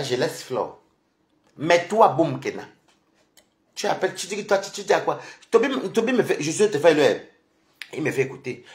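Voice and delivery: ordinary talk, nothing marked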